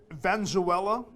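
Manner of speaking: usual speaking voice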